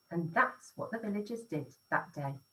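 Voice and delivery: plain reading voice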